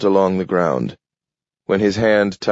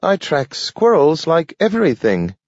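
much higher eager dog voice; a little dog offering to help, pitched up; Nathaniel the dog; 10 semitones higher than usual. A narrator's reading. Dog Nathaniel begging Grant to take him, high dog voice. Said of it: "Nathaniel told him".

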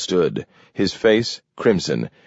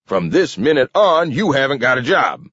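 higher-pitched angry roar, held in; 8 semitones higher, 3 dB louder than usual. A narrator's reading. King sacking Webster, roaring. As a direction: fire him furiously. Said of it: "roared King".

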